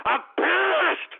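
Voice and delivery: high, strained yell of anger; pissed off. Real, not acted. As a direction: blow up on the last word, dragging it out. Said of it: "I'm sorry if I sound, like, a little upset".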